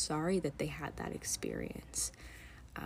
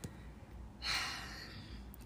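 shaky sigh; 11 dB quieter than usual. Real, not acted. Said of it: "I'm choking up".

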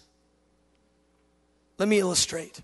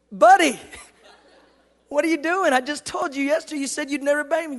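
exasperated dad; 6 semitones higher, faster than usual. Retold or imitated. Exasperated dad scolds son, acted.

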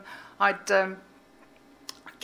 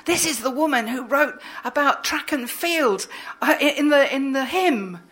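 excited introduction; 6 semitones higher, 6 dB louder than usual. Retold or imitated. Retold scientist introducing her.

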